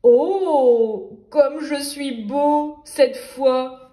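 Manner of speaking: loud, slow, gushing admiration of himself; the vain wolf swooning at his peacock feathers; wolf delighted. Acted. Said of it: "et s'exclama".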